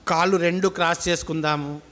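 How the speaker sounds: slow, drawn-out meditation guidance; measured phrases with long settling pauses; guided meditation. Real, not acted. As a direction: lead them into breath awareness, unhurried.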